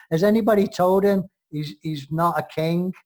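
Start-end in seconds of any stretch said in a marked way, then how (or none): none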